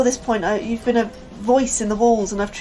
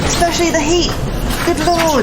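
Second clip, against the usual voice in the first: louder, higher, overwhelmed by heat; shouting over noise; 5 semitones higher, 7 dB louder than usual. Acted. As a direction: yell it over the roar. Shouting in the hot engine room.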